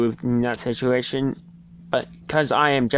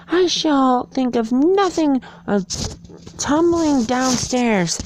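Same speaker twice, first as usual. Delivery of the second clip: Alice's voice; 14 semitones higher than usual. A narrator's reading. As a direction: speak as young Alice, high and earnest. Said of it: "thought Alice to herself".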